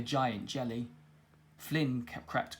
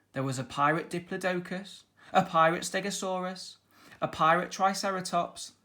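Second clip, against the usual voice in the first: ordinary delivery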